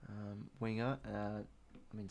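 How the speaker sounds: ordinary talk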